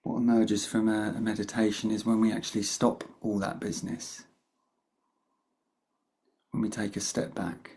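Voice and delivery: calm meditation guide with long pauses; guided meditation. Real, not acted. Meditation teacher opening a session for the group, unhurried guidance with long pauses. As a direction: lead them gently in, leaving long silences between phrases.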